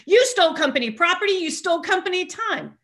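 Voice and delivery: high-pitched, emphatic accusation; scolding sponsor. Retold or imitated.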